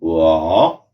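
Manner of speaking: वा said low, dipping in the third tone. Shown as a demonstration. Teacher models वा in the third tone. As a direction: dip the voice low on वा. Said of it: "इसलिए इसको pronounce करेंगे".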